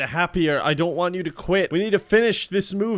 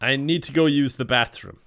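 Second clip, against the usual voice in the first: ordinary talk, nothing marked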